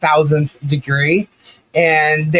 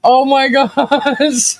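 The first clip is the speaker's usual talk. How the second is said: high, slow, laughing through the words; helpless laughter breaking the voice; 9 semitones higher, much slower than usual. Real, not acted. Laughing at a viewer's joke, high and slow.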